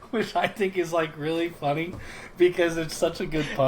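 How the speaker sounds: talking through real laughter; amused. Real, not acted. Host enjoying the bad beef pun, amused and laughing.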